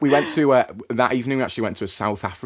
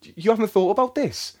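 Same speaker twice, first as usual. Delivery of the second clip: pitched up, teasing alarm at his friend; mock-alarmed, mate you haven't thought about this; high, urgent warning; 9 semitones higher than usual. Warning stubborn friend Danny about Christmas dinner, high and teasing.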